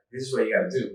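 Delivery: ordinary delivery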